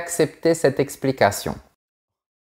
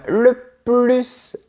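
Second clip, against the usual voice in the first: slowed explanation; slower than usual. Shown as a demonstration. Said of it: "Commençons par la lettre finale".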